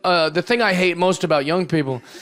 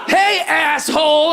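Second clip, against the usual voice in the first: high, slow, drawn-out shout; hammed-up confrontational holler; mock angry heckle; 9 semitones higher, 4 dB louder, slower than usual. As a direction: yell like an outraged young activist. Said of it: "girl with a green mohawk's gonna pop out".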